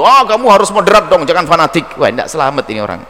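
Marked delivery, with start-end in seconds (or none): 0.0-1.9 smug lecturing. Retold or imitated. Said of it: "Sombongnya yang muncul".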